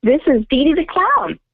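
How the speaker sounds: bright put-on clown intro. Shown as a demonstration. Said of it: "What was that?".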